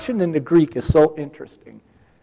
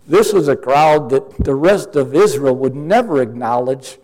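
normal talking voice

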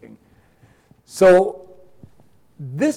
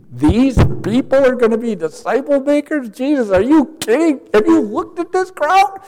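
rising higher and higher in disbelief; incredulous scoff; 7 semitones higher than usual. Retold or imitated. Israel doubting Jesus' choice of disciples, incredulous and rising higher. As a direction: scoff as if it's absurd.

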